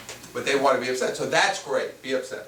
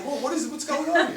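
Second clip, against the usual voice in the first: mock incredulous; 11 semitones higher than usual. Mocking the friend's question.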